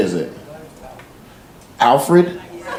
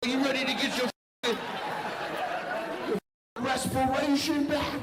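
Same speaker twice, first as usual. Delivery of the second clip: higher, pumped-up bro doctor voice; hype doctor; 11 semitones higher, 14 dB quieter than usual. Retold or imitated. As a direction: greet the patient like a gym buddy. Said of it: "if he's like".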